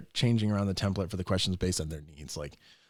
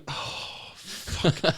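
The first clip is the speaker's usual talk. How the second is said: whispered curse; 5 semitones higher than usual. Retold or imitated. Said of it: "he's like".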